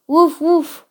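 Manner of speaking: high, loud woofs; puppy's bark. A narrator's reading. Puppy solving the troll's riddle, high barking. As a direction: bark out the answer like a pup. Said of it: "barked Piero".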